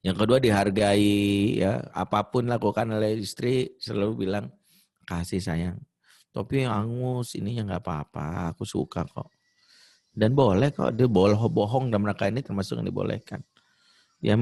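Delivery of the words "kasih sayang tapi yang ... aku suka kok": gentle praise. Acted. Husband praising wife's cooking.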